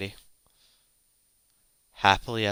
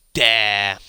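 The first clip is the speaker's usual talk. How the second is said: defiant shout; 9 dB louder than usual. Acted. Lucia answers the villain's challenge with a defiant shout. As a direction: shout it back louder.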